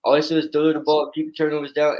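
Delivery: animated, rising argument for his quarterback; fired-up defense. Real, not acted. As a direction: sell it like you believe every word. Passionately defending his Commanders pick.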